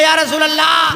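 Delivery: rousing devotional call; impassioned invocation. Shown as a demonstration. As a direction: cry it out from the heart. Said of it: "तड़प के बोले".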